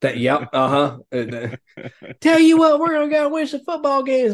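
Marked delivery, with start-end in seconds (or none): 2.2-4.3 hammed-up Southern coach pep-rally voice; fake Southern accent. Mocking the coach's fake Southern accent to his co-hosts, hammed-up Southern. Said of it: "Brian Kelly came in with that fake Southern accent".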